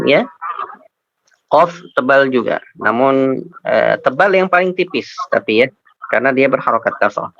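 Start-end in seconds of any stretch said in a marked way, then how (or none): none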